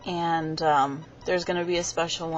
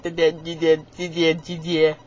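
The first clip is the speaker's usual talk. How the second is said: lightly voiced stuck stammer, louder; mock stutter; 5 dB louder, slower than usual. Teasing how Dakota will stutter, mock stammer. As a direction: get stuck on the word again and again. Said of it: "he started stuttering".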